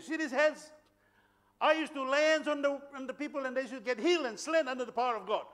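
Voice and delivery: high, put-on Angan accent; powerful Angan accent. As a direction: imitate a preacher boasting in an Angan accent. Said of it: "in a powerful Angan accent".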